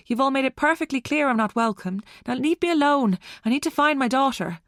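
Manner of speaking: high, urgent brush-off; agitated. A narrator's reading. Mother rebuffing Dylan to find her daughter, agitated. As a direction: push him away, pressed and upset. Acted quarrel while seeking missing child.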